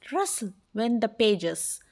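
ordinary delivery

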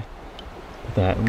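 slow, hushed breath of admiration; awed whisper. Real, not acted. Angler whispering slowly in awe at the beautiful walleye.

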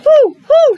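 very high, loud whoo whoo; owl hooting. A narrator's reading. Reading to a child, hooting loudly as the owl. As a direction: hoot like an owl in the night. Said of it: "said the owl".

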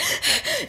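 high sharp intake of breath; sharp gasp. Retold or imitated. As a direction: gasp as the idea hits. Sudden idea, gasp.

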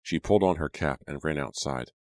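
ordinary delivery

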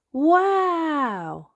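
high, amazed wow; children awestruck; gasping wow. Acted. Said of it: "gasp Peppa and George".

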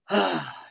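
imitated weary sigh; long exasperated sigh. Bandleader sighs when asked for money, imitated exasperated sigh. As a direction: heave a sigh as the put-upon bandleader. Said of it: "You hear Tom go like that".

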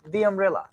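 the said as thee before umbrella; the umbrella, with the pronounced thee; the before vowel. Shown as a demonstration. Teacher showing the class the said as thee before a vowel. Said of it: "can I say the umbrella or the umbrella".

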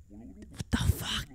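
slow, soft breathless whisper; stunned whisper. Real, not acted. Streamer whispering in shock at the viewer count. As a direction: barely breathe it out.

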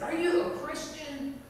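high-pitched, startling question; bold challenge. Retold or imitated. Beggar grabs her cross and questions her, bold challenge. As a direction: put it boldly, as a direct challenge.

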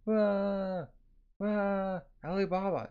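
high, drawn-out fake wail; mock bawling. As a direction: bawl theatrically in long held cries. Alibaba bawling, acted out.

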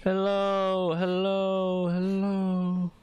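high slow calling voice. Pretend. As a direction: call into the empty house.

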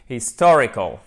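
HISTORICAL with TOR hit hard and loud; stress on second syllable. Shown as a demonstration. Teacher correcting the mistake, loud stress on TOR in HISTORICAL. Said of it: "l'accent tonique ici sur la seconde syllabe".

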